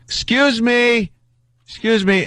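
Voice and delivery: high, slow, drawn-out excuse me; calling the neighbour. Retold or imitated. Keith calls the neighbour over the fence, high and drawn out. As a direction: call politely over the fence, stretching each word. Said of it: "turns to the neighbor and goes".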